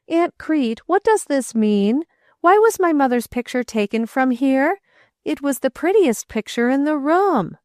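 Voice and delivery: put-on a boy protesting the missing picture; grieved, indignant boy. A narrator's reading. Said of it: "The boy's tone expressed grief and a suggestion of indignation".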